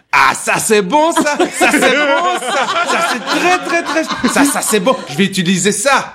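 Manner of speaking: the laughing driver, in a hammed-up French accent, delighted; odd French accent. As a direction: ham up a French man's delighted exclamation. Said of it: "ça, c'est un Français, mon accent".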